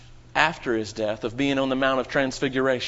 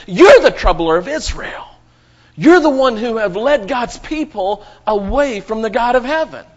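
voicing Elijah; 5 semitones higher, 7 dB louder than usual. Elijah rebuking the king.

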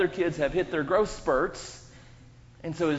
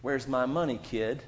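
bully taunt; 5 semitones higher, 5 dB louder than usual. Retold or imitated. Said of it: "They walk up and they say".

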